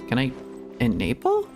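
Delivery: usual speaking voice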